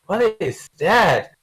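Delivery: imagined onlooker's slow double take; baffled onlooker. Acted. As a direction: stretch it out slowly, puzzled. Voices imagined onlooker looking down.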